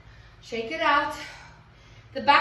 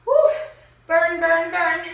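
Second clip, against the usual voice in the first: loud cheer; 3 semitones higher, 7 dB louder than usual. Real, not acted. Coach cheers through the burn.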